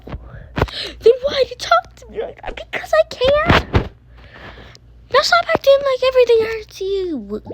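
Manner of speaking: high, quick bratty comeback; sassy retort. Real, not acted. Sister firing back at her sibling. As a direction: throw it back defiantly.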